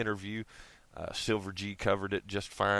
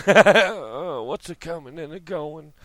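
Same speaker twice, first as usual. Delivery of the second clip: lightly voiced mock Italian, a little higher; a half-hearted, botched Italian accent; Italian Bill Clinton; 5 semitones higher, 7 dB louder than usual. Vlogger attempts a botched Italian Bill Clinton impression. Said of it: "I can't do it".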